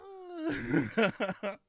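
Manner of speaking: a high, gleeful cackle. Real, not acted. Laughing at his own suppository joke, high and gleeful.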